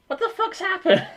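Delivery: high mock baffled squeak; chat viewer voice. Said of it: "Somebody in chat will probably be able to go".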